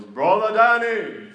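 put-on loud, slow call in an Indian accent. Pastor calling back to Danny in a thick Indian accent. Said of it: "Thick, thick accent".

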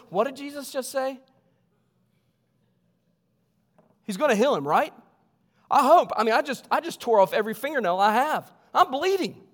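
pirate-voiced friend. Friend on the roof, worried, in a light pirate voice. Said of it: "pretended like he was a pirate".

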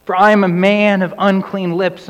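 crying out. Acted. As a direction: confess it aloud in distress. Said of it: "And he cries out".

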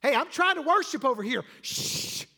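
loud, high scolding ending in a shush; indignant complaint. Acted. Irritated churchgoer to a noisy worshipper, indignant. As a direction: complain loudly, then hush them. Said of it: "are you that person going".

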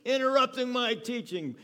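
sudden high furious shout; imagined yell. Pretend. Jesus imagined scolding the paralytic, loud angry yell. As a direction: bark it out as an irritated teacher interrupted. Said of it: "ready for Jesus to yell at him".

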